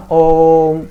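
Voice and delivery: ओम held long like a chant. Shown as a demonstration. Teacher showing how long ओम takes, drawn out. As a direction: stretch the vowel of ओम to show pluta. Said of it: "जैसे हम बोल के देखते हैं ओम".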